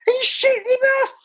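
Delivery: very high squealed outburst; hammed-up falsetto cry; retold exclamation. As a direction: squeal it in mock disbelief, imitating a past reaction. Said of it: "I was saying".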